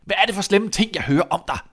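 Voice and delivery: high, loud scolding; angry. Acted. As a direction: confront the son angrily. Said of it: "sagde han vredt". (vred, skældende)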